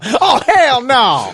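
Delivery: loud, slow, drawn-out "hell no"; hammed-up sassy refusal; mock album catchphrase. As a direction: belt it out like a comedy track. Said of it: "track seven on [name]'s album".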